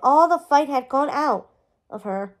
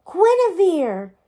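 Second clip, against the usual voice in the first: slow, raised cry of disbelief; 5 semitones higher, 5 dB louder, slower than usual. A narrator's reading. Merlin realising who the girl is, amazed disbelief. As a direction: draw the name out, amazed and louder, no whisper. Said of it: "Merlin whispered in disbelief".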